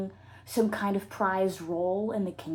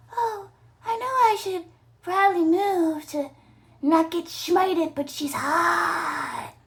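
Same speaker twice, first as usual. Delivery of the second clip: lovestruck exclaim; 8 semitones higher, 6 dB louder, slower than usual. Acted.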